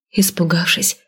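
ordinary delivery

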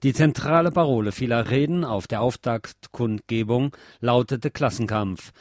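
ordinary delivery

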